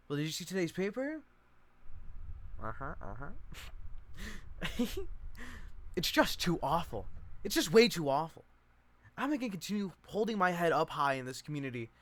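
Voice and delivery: fussy cousin Chick. Acted. Reads Chick's lines in play.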